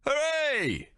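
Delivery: high hooray in another voice; excited crowd hooray; cheering. Acted.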